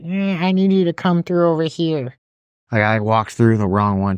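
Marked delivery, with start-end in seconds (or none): 0.0-2.1 high put-on old lady, bossy; higher, bossy old lady. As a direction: play a fussy old lady at the gate. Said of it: "this old lady was like".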